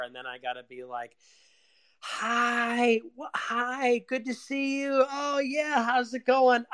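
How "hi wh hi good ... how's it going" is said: high, bright fake-polite party voice, much higher; fake cheerful greeting. Greeting people at a party, forced fake cheer. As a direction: put on a forced cheery hello. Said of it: "hate having to fucking fake laugh".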